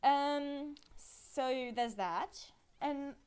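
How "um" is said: normal talking voice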